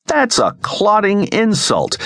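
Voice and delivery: high, slow-stretched indignation; indignant protest. A narrator's reading. Sten is asked if a woman is involved, indignant. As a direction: protest as if gravely insulted. Mock outrage at teasing question.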